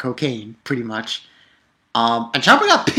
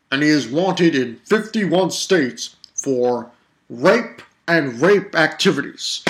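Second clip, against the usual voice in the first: slow, louder mock wanted-poster announcement; mock TV crime announcer; 3 dB louder, slower than usual. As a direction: ham it up like a TV crime bulletin. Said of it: "Belongs on America's Most Wanted".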